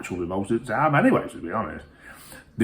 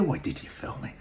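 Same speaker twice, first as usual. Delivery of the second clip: whispering the question back in mock disbelief; 11 dB quieter than usual. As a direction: hiss it out, mocking their question.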